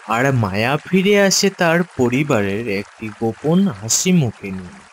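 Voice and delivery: plain reading voice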